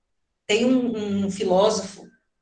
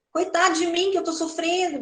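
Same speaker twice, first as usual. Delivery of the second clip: high, mock poor-me lament; self-pitying whine; 7 semitones higher than usual. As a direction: play the pitiful victim, a bit hammed-up. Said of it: "síndrome do coitadinho". (lamento de coitadinho, meio exagerado)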